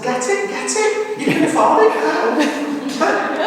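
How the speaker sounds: high and slow, laughing as she urges; laughing encouragement. Retold or imitated. Daughter urging mum on, laughing and high. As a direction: egg her on, pitch way up.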